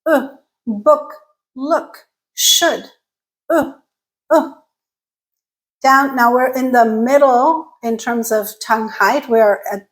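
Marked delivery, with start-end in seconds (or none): 0.1-4.5 "book, look, should" with short rounded vowel. Shown as a demonstration. Teacher modelling back vowel, short and rounded. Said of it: "It's also rounded".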